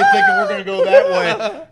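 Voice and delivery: squeaky high laughing through the words; can barely get it out for laughing. Real, not acted. Laughing while telling a friend about the ride.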